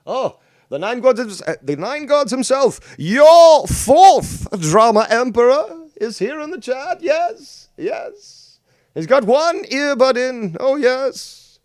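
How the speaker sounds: high, slow, grandly pompous proclamation; pompous emperor voice. Acted. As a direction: announce like a haughty emperor. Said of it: "the fucking pompous, emperor fucking voice".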